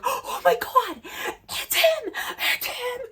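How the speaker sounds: high, breathless fangirl squeal; excited squeal. Retold or imitated. Caller squealing to her friend about the star. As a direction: squeal it like your idol just called.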